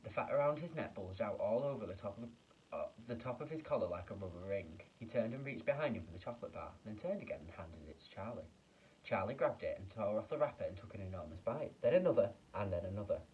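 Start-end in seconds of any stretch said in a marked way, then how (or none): none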